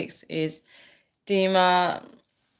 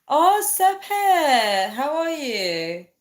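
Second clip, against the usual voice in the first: excited greeting; 8 semitones higher, 3 dB louder, much slower than usual. Real, not acted. Greeting a new viewer warmly.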